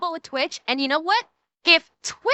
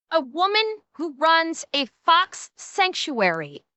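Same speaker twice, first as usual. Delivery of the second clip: normal talking voice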